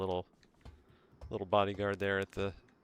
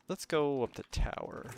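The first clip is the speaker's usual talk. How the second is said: normal talking voice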